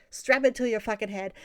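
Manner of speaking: loud, exasperated comeback in a retold argument; exasperated retort. Retelling the argument over filming the cat, loud exasperated retort. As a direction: fire it back loudly, imitating your own outburst.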